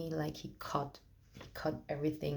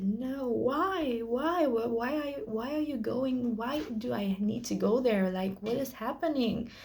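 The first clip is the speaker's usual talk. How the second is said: high, pleading cries of why; a retold scream, higher and a little louder; retold crying scream; 7 semitones higher, 3 dB louder than usual. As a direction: relive the panic, pitched up and pleading. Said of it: "I cried and I screamed".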